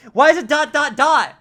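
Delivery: loud, high yell of frustration; exasperated outburst. Real, not acted. Streamer needing to connect the credits, exasperated yell.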